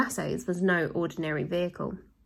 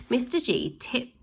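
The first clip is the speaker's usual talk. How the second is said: usual speaking voice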